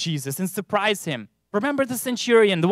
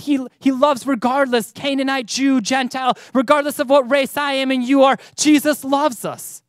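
loud, raised preaching voice; 5 semitones higher, 5 dB louder than usual. Acted. Preacher proclaims God's love loudly to the church. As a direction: proclaim it to the whole congregation.

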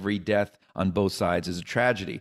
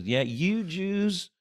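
Kentucky booker; 3 semitones higher than usual. Retold or imitated. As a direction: ham up a gushing small-town booker. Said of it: "He goes".

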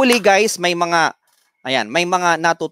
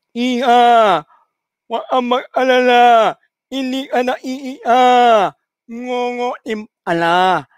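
higher, louder nasal voice, slowing at the end; nasal sacristan joke; 7 semitones higher, 3 dB louder, slower than usual. Acted. Sacristan behind the statue teasing the woman, nasal mock reply. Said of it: "Sabi nung sakristan".

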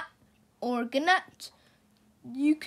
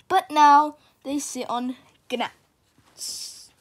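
ordinary delivery, nothing marked

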